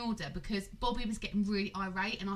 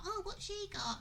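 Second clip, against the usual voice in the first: put-on child's voice; 11 semitones higher, 7 dB quieter than usual. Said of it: "my son was like".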